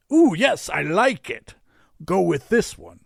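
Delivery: high, loud, gleeful approval; delighted Santa. A narrator's reading. Santa picks the last rocket, delighted. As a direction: open on an excited coo, then decide.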